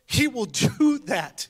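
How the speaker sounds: joyful laughing insistence; laughing emphasis. Real, not acted. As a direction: say it again, delighted and laughing.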